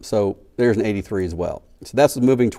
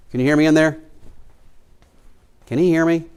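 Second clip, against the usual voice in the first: raised voice calling to another room; 6 semitones higher, faster than usual. Real, not acted. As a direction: call out to the crew next door. Calling to crew in next room.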